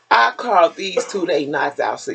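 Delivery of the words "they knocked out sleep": ordinary delivery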